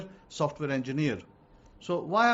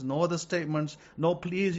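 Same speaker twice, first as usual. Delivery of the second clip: ordinary delivery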